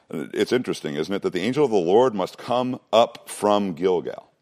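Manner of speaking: usual speaking voice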